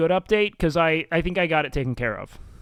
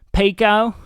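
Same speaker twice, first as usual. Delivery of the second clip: scornful mock of people saying Pico, higher and louder; mocking shorthand; 5 semitones higher, 8 dB louder than usual. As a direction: sneer out Pico like the people he hates. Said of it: "When people say Pico".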